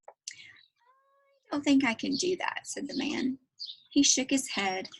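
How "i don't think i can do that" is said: whispering. Acted. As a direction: breathe it out timidly, slowly. Shy shopkeeper Otis answers.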